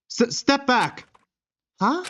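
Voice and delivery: sharp, high startled warning; startled cry. Acted. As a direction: jump back and warn them off. Startled pony warning intruders.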